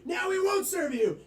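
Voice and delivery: high, loud mock refusal; hammed-up bartender putting a foot down; acted refusal. Bartender flip-flopping, higher refusing voice.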